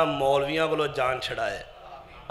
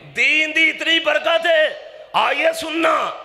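high, slow pulpit shout; ringing, high-pitched preacher's cry; rallying call; 11 semitones higher, 4 dB louder, slower than usual. Real, not acted. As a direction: proclaim it to the whole congregation. Preacher rallies the congregation.

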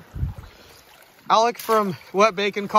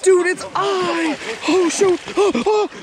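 frantic shout; 9 semitones higher, slower than usual. Real, not acted. Fish breaking him off.

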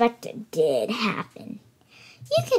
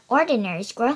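ordinary delivery, nothing marked

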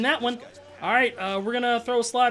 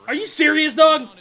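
higher and louder, can't believe it; exasperated outburst; 5 semitones higher, 8 dB louder than usual. Real, not acted. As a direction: fling the question at the screen. Disbelief at game play.

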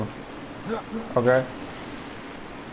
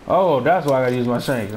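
normal talking voice

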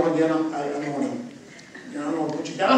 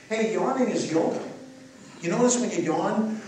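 ordinary talk, nothing marked

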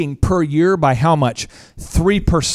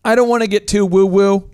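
a little higher and louder, teasing; playful warning; 4 semitones higher, 3 dB louder than usual. Real, not acted. Host teasing co-hosts before a tangent, playful warning. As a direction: warn them off woo-woo with a grin. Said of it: "I kinda do".

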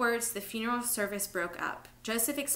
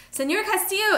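very high, loud call; panicked high shout; alarmed cry; 11 semitones higher, 10 dB louder than usual. A narrator's reading. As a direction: cry out for the lost man. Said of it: "Isabel cried".

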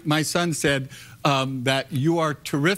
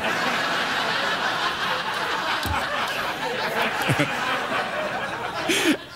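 high laugh; 13 semitones higher than usual. Real, not acted. Host's collar pops up.

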